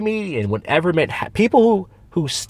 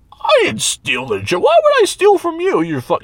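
indignant denial; slower than usual. Retold or imitated. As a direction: play the thief, offended and getting shrill. Acting the denying joke thief.

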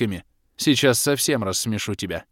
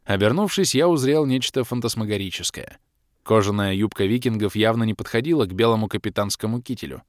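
ordinary delivery

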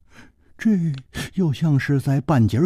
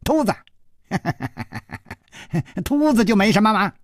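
high, gleeful dwarf with a chuckle; chortle, then tease in a pitched-up voice; giggling; 9 semitones higher, faster than usual. A narrator's reading. Dwarf unoffended at being called bald, giggling. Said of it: "反而笑嘻嘻地说".

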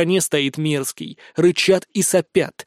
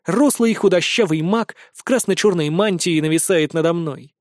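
usual speaking voice

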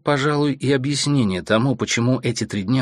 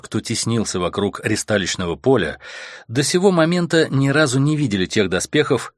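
ordinary delivery

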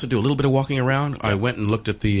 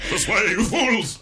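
high, drawn-out wizard call; Gandalf; 9 semitones higher, slower than usual. Acted. Comedian replays the wizard's line, slow high call. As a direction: stretch it out like a wizard leading the way.

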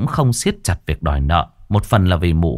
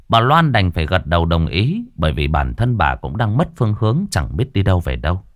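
plain reading voice

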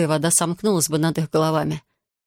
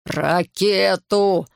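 angry hoarse rasp; much slower than usual. A narrator's reading. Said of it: "зло прохрипел Филлипсон".

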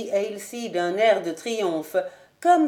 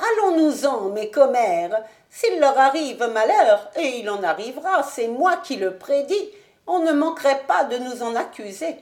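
a lightly voiced old prophetess, higher and louder; croaking sibyl; 4 semitones higher, 4 dB louder than usual. A narrator's reading. Old sibyl urging her fellow gossips to leave, croaking. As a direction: croak the warning like a crow scenting death. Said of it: "croassant comme des corbeaux".

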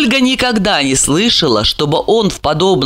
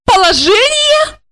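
high, drawn-out incredulous question; high-pitched slow derision; scornful echo; 3 dB louder than usual. A narrator's reading. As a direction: throw the word back in a high, mocking voice. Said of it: "фыркнула и грубо прервала его".

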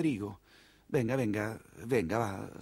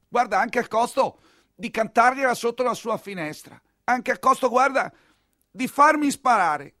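impassioned vow; 7 semitones higher, 4 dB louder than usual. Acted. Lovesick count declares.

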